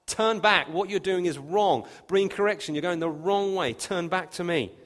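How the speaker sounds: stern rebuke. Acted. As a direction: call them back loudly, like a scolding prophet. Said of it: "She's basically effectively telling them off".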